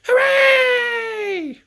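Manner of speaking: very high, loud joyful cheer. Acted. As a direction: shout for joy. Everyone cheers.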